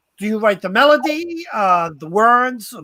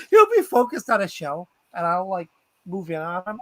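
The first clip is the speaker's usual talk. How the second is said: ordinary talk, nothing marked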